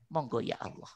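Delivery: low and soft, a whispered-near yes to God; soft humble yielding. Acted. A believer surrendering to Allah, low and soft. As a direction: give in quietly and reverently.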